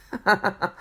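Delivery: short, high acted chuckle; light storybook laugh. A narrator's reading. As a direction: chuckle as a jolly character. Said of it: "laughed Mister Happy".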